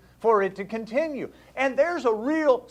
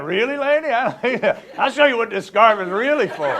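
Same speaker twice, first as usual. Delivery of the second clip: louder, laughing through a pretend comeback; mock threat, laughing; 9 dB louder than usual. Imagining telling off the woman, louder and laughing. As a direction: play the tough comeback while cracking up. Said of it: "I'd be like".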